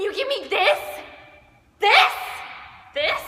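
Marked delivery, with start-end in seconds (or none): 1.8-3.2 louder, higher yelp; outraged shout. Acted. Duet actor yells in mock outrage again.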